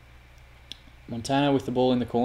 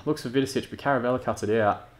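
ordinary delivery

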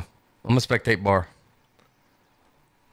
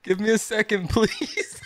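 laughing mockery; 7 semitones higher, slower than usual. Mocking friend's plea, laughing.

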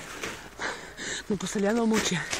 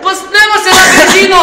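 high, very loud survival-host command; urgent shout; 8 semitones higher, 12 dB louder than usual. Acted. Survival host on a slope, urgent high shout. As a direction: shout the plan over the struggle.

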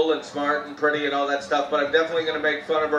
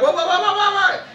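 much higher, rapid alarmed what; husband's frantic what, what; panicked what; 12 semitones higher, 4 dB louder than usual. Retold or imitated. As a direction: rattle it off in a panic. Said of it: "And I was like".

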